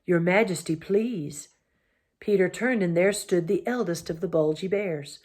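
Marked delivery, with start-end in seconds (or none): none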